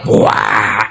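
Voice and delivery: deep mock lion roar, low and rough; a storyteller's playful roar; lion roar imitation. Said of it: "એને સીધી ત્રાડ પાડી".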